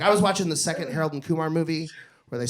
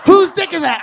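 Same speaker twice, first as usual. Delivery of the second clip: shouted, much higher and louder; exasperated shout; 12 semitones higher, 12 dB louder than usual. Retold or imitated. Husband yelling back at wife's question, exasperated shout. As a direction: yell it back, fed up. Retorting to her repeated question.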